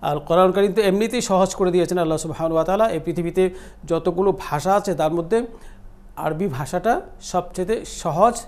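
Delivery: ordinary delivery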